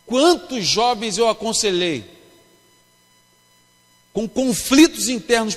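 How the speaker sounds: slow, loud, drawn-out pulpit cadence; fervent preaching. Acted. Pastor preaching to a youth congress, fervent and emphatic. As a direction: preach it to the crowd, building.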